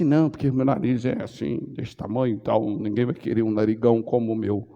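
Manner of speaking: voz baixa e mais grave, imitando lamúria; lamento imitado. Retold or imitated. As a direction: imite uma pessoa se queixando baixinho. Fiéis reclamando de si mesmos. (lamúria imitada, voz baixa)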